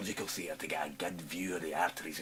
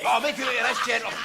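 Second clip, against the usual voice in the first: loud eager rush; 11 dB louder, faster than usual. Acted.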